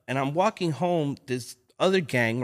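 ordinary talk

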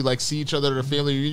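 usual speaking voice

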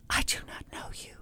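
imitating a scared whisper, barely audible; mock whisper. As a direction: breathe it out like a frightened witness. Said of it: "Here's how she did it".

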